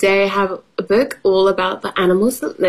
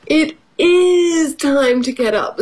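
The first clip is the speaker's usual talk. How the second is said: higher dog voice, insistent on "is"; raised pitch, leaning on "is"; storybook dog; 7 semitones higher than usual. Acted. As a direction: insist like a dog in a picture book. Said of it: "said dog".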